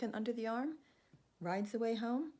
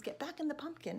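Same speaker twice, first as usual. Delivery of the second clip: higher mock scream; mild scream; 8 semitones higher than usual. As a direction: call them back into the pumpkin. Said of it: "So he screams".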